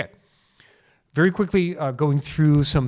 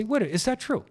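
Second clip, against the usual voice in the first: surprised self-check; 10 semitones higher than usual. Shown as a demonstration. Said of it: "and say".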